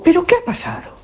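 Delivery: high-pitched retold astonishment; concerned question. To a woman coming out in tears, concerned. Asks woman who came out crying.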